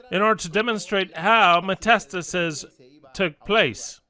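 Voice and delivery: ordinary talk, nothing marked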